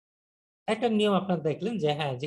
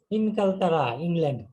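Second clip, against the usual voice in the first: ordinary talk, nothing marked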